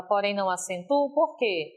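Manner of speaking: normal talking voice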